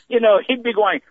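ordinary talk

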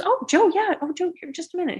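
light up as the name clicks; cheery nurse. Retold or imitated. Nurse suddenly recognizes Joe's name.

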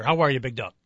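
ordinary talk

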